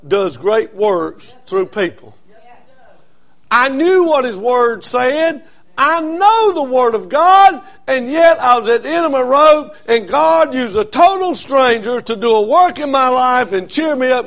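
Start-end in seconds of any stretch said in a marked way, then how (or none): 3.5-14.2 preaching at full force. Acted. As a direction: bring it home like a preacher. Preaching climax.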